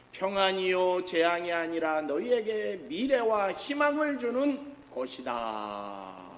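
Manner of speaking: 장엄하게 천천히 읽는 말씀; 끝을 느리게 늘여 선포하듯. Acted. (회중에게 예레미야 말씀을 장엄하게 낭독)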